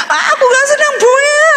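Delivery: high, tearful, half-laughing whine; mock sobbing refusal. Heartbroken girl refusing her father's offer, laughing mock sob. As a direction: whine it through mock tears.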